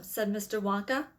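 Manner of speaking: plain reading voice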